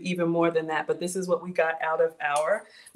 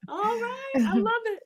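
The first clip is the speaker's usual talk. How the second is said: high, laughing shout-out; delighted cheer; 11 semitones higher than usual. Real, not acted. Host cheers a viewer's hometown.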